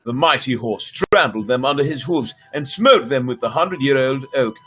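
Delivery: ordinary delivery, nothing marked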